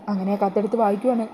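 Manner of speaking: ordinary delivery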